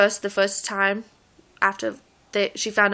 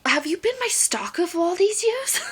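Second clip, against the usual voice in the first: incredulous; 9 semitones higher than usual. Retold or imitated. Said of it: "And I'm like".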